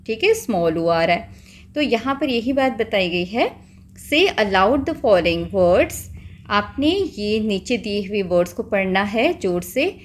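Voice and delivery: ordinary talk, nothing marked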